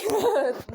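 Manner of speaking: high, loud put-on laugh; mock laugh. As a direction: burst out in a pretend giggle. Imagined laughing reaction.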